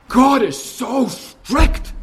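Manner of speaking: slow, gasping fake shock; mock gasp of outrage. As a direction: gasp as if scandalised. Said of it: "voicing Satan's scorn".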